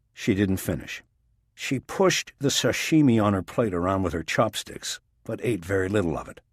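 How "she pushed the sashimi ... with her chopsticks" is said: ordinary delivery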